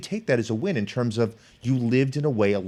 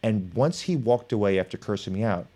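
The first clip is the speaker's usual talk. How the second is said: ordinary talk, nothing marked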